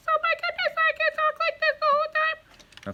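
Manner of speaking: very high, loud silly squeak; squeaky voice. Shown as a demonstration. Parent showing kids silly reading voices, piercing squeaky voice. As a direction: squeak it out far above your normal pitch. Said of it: "do it in a squeaky voice like this".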